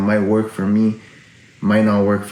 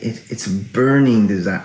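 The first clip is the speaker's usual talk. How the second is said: ordinary talk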